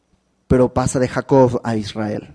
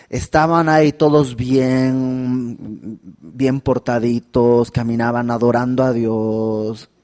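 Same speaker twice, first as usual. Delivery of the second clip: ordinary talk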